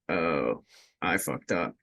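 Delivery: low, subdued mock admission; mock sheepish realization. Skater imitating a cowed newcomer, low sheepish realization. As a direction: imitate someone quietly backing down.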